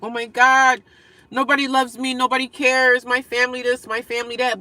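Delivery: a mild hammed-up wail of nobody loves me; mock self-pity. Retelling crying alone in her car, mock self-pitying whine. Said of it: "I was in this car at night crying".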